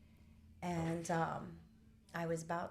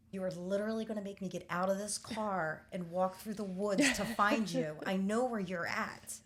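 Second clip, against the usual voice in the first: louder and building, slowing down on I know where you're at; exasperated insistence; 7 dB louder than usual. Retold or imitated. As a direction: push it harder and louder to the end. Pleading angrily with brother in woods.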